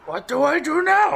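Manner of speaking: high goofy monster voice. Retold or imitated. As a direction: squeak it like a silly monster. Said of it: "she's going full monster".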